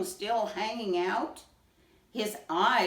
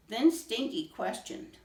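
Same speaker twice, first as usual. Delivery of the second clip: plain reading voice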